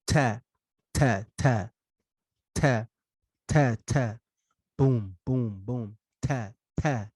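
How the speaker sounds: ta said into the mic with a boom of breath; aspirated ta. Shown as a demonstration. Teacher shows the wrong airy ta. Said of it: "you'll hear, like, a boom sound".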